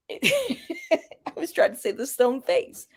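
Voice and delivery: talking through laughter; amused, words slowed by giggles; laughing. Real, not acted. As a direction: laugh your way through the admission. Laughs at her own Botox jab.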